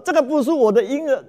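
high, loud wailing, stress on 个; wailing plea. Acted. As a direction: cry it out, stress on 个. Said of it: "大声的嚎哭啊".

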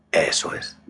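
whispered right in your ear. Acted. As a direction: breathe the words close and soft. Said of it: "y escucha mi voz". (susurro al oído)

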